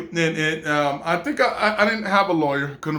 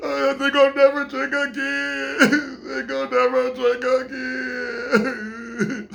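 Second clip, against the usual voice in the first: mock crying vow; 6 semitones higher, slower than usual. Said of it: "I was like".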